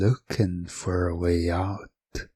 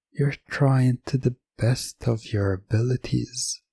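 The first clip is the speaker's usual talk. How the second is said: normal talking voice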